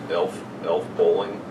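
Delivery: normal talking voice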